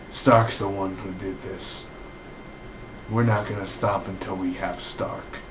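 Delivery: lightly voiced stern captain, slow and low-key; a hint of a grim official laying blame, slowly. Captain blames Stark, quoted.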